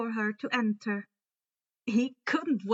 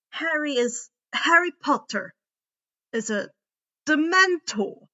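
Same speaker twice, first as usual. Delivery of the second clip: startled realisation; 4 semitones higher, 6 dB louder than usual. A narrator's reading. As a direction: repeat it as if you can't believe it.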